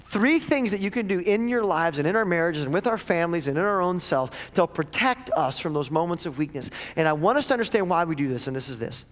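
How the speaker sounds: ordinary talk, nothing marked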